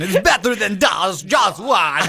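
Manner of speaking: put-on light Dutch accent, slowed and half shouted; mock Dutch accent. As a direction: play it as a joking Dutchman. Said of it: "I have a Dutch accent all of a sudden".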